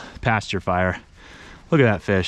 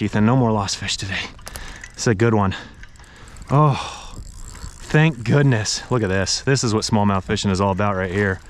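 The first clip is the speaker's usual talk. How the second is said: usual speaking voice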